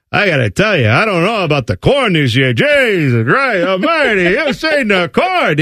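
broad mock New Jersey accent, vowels stretched and swooping; New Jersey farmer accent. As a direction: play a griping farmer with a thick Jersey accent. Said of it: "Everyone I know talks like that".

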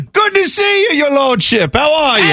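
high, hammed-up English servant; fake English accent. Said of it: "fake accent".

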